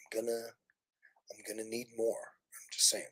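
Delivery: soft unvoiced whisper; teasing whisper; whispered aside. Real, not acted. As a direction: murmur it as a sly aside.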